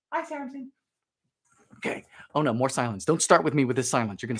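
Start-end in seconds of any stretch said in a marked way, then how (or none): 0.1-0.7 soft, very high hello; high greeting. Acted. Host greeting a viewer's son, high and soft. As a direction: greet him in a tiny high voice.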